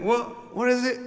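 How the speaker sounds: high confused question; puzzled. Retold or imitated. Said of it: "And I was like".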